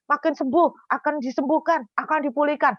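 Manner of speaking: high, fervent declaration; renewed shouting. Retold or imitated. Believers shout for healing against hardship, high fervent cry. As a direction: cry each promise out insistently. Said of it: "terus berteriak lagi".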